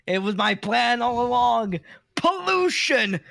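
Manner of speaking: high, loud, slow gloating villain; mad scientist. Pretend. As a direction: gloat like a mad scientist revealing his plan. Villain joke about plastic pollution.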